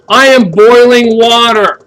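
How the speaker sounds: booming mock triumph, slowed; triumphant announcement. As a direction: proclaim it like a great discovery.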